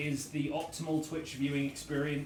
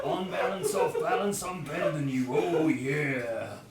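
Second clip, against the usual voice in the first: louder put-on voice for a skit; performed bit; 6 dB louder, slower than usual. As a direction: deliver the bit with swagger. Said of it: "you can do the bit".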